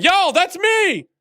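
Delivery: high, slow, thrilled cry; excited shout. Real, not acted. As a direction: shout it out with joy. Finds his reported bug fixed.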